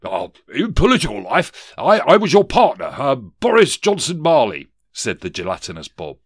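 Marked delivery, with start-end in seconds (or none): 0.0-4.7 high, loud, hesitant politician's ghost; blustering ghost. Acted. Ghost introduces himself to former partner, loud and blustering. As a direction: bluster loudly, stumbling over the start.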